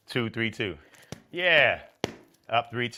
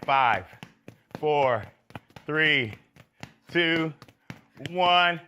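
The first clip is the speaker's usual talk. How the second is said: building louder and higher countdown; calling out countdown; 4 semitones higher, 6 dB louder, much slower than usual. Real, not acted. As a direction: shout the count up to time. Coach counting down final seconds.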